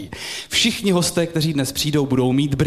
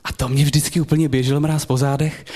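normal talking voice

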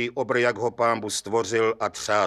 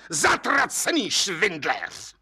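high, drawn-out furious curse; angry roar; 7 semitones higher, slower than usual. A narrator's reading. Judge cursing the departing suspect, roaring angrily. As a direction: roar the insult slowly, voice raised high. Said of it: "zařval okresní soudce".

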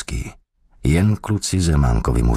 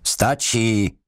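higher, restrained call to stop; insistent order; 6 semitones higher than usual. A narrator's reading. Interrogator calls off his raging helper, raised insistent order. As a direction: call him off more insistently.

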